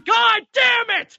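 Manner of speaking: high, slow, loud hammed-up yell; mock rage. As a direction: drag out an exaggerated angry shout. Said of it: "Ryan going".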